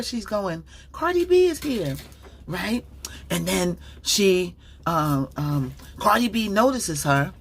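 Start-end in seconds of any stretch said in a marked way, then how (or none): 0.9-2.0 high, thrilled star-struck gasp; excited fan squeal. Retold or imitated. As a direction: imitate a fan spotting a celebrity. Guard repeats excitedly.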